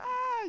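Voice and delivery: short high-pitched mock scream; high acted "ah" of a scream. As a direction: squeal it out at moderate volume. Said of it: "you know, screaming".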